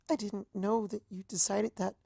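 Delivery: stunned Azalea. A narrator's reading. Azalea hears of the new date, hushed and hurt. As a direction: barely get the words out.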